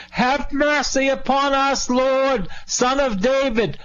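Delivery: high-pitched hollering cry for mercy; hollered plea. Acted. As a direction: holler it out high, like blind men desperate to be noticed. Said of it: "how they did it was they hollered".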